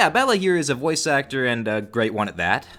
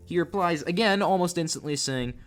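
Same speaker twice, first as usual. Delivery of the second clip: usual speaking voice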